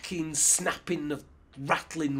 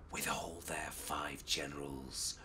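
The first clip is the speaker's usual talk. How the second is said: low, hushed secret whisper; 12 semitones lower, 12 dB quieter than usual. Acted. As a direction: whisper it to a fellow spy. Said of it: "Ras Alula whispered".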